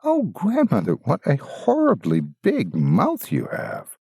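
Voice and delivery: put-on high, slow, wide-eyed girl; wondering girl. Girl questioning the disguised wolf, high and slow. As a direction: marvel at each strange feature.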